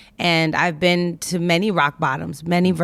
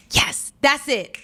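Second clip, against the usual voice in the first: slow, higher-pitched triumphant yes; 8 semitones higher, slower than usual. Retold or imitated. Speaker celebrating their intuition on the road, triumphant. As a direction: savour the win, drawn out and pitched up.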